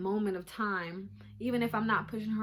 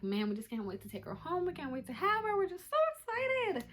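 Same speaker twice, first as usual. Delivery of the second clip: rising into a high squeal of excitement; high-pitched giddy quote; gushing excitement; 9 semitones higher than usual. Retold or imitated. Quoting themselves, can't wait.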